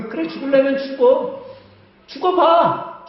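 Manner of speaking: high, loud scornful taunt, 죽어봐 drawn out slowly; contemptuous dare. Retold or imitated. Fed-up wife taunting husband on the balcony, loud scorn. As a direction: hurl it at him like a dare. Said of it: "아내가 어떤 생각하는지 아세요?".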